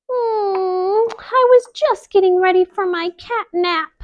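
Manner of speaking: high lazy kitten purr; high drowsy cat voice; sleepy kitten voice. Acted. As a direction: yawn it like a drowsy kitty. Kitten speaks.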